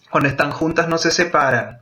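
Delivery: usual speaking voice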